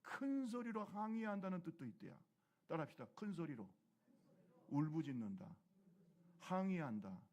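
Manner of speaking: ordinary talk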